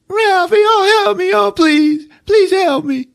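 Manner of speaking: high, loud pleading wail; mock crying kid. Fallen boy begging Ralphie for help, mock crying. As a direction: beg tearfully like a bawling little brother. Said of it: "now he's really crying".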